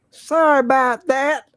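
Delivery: high, drawn-out mock Southern drawl; teasing, exaggerated slow Southern accent. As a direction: mimic a Southern drawl, very slowly. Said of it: "Slow Southern accent".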